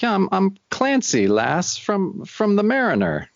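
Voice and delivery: a fake Irish longshoreman introducing himself in a bad brogue; mock Irish brogue to Jack's sister; terrible brogue. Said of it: "some kind of terrible brogue".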